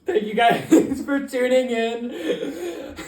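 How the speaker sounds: slow sign-off breaking into laughs; slowed outro, laughing. Real, not acted. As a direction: fight laughter through the outro.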